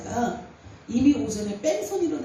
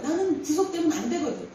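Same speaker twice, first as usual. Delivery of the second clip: normal talking voice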